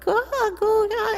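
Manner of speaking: put-on high-pitched baby cooing; imitated baby babble. Storyteller imitating the baby on the plane, high babble. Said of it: "the baby started out like".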